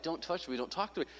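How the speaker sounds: soft, prickly warning under the breath; mock-irritable, kept very quiet. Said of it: "You ever get prickly?".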